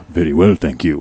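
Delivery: low, loud, smooth reply; Richard's low voice. Retold or imitated. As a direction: croon it like the lady's man. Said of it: "his voice is really low and he has this faint accent".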